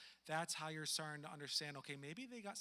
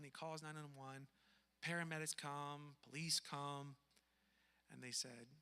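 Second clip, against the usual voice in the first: ordinary talk, nothing marked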